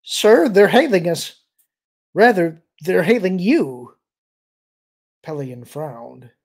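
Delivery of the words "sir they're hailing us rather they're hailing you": high, loud, slow nervous report; a rattled junior officer's raised pitch; unnaturally high-pitched. A narrator's reading. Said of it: "his voice unnaturally high-pitched".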